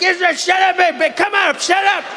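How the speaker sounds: hammed-up fed-up father shouting; exasperated dad. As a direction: yell at bickering kids to shut up. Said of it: "like a dad who had kids that were fighting".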